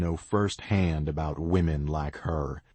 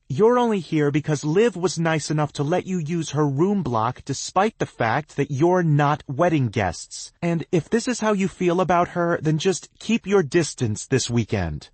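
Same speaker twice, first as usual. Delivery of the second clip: angry; 11 semitones higher, 6 dB louder than usual. A narrator's reading. Cass angry rebuke.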